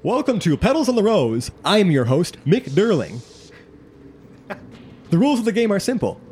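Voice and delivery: high, projected announcer voice; big loudspeaker emcee, pitch leaping up; booming game show host. Acted. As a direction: welcome the crowd as a booming host. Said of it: "a voice begin to boom".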